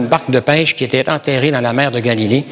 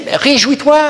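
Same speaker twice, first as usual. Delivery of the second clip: exhortation joyeuse; 9 semitones higher than usual. Acted. Preacher exhorting joy.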